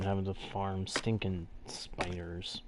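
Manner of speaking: breathy whispered spiders, dropped away; whispered disgust. Real, not acted. Player tired of farming spiders, whispered with distaste. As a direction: hiss it out in distaste.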